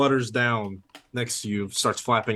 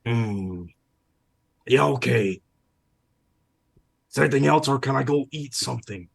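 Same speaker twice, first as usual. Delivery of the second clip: impatient hungry seagull; 3 semitones higher than usual. Acted. Seagull asking to leave for food, impatient bird voice. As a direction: grudgingly wrap up so you can go eat. Game master plays the seagull.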